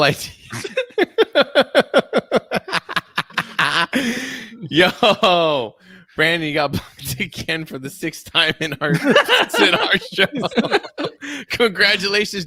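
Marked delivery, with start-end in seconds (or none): 1.3-12.4 laughing through the words, pitched high; giggling while teasing a friend. Real, not acted.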